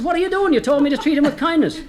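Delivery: high-pitched, indignant outcry; acted astonishment, voice jumping right up; shocked protest. Friend protests at the mule being hit.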